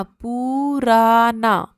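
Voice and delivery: पुराना very slowly, every syllable stretched; drawn out. Shown as a demonstration. Teacher breaking the word up for children to write, drawn out. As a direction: sound out पुराना with long held vowels.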